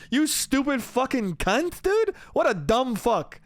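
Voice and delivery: high, incredulous name-calling; scornful insults. Retold or imitated. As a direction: berate a fool in disbelief. Retold insults at drunk man.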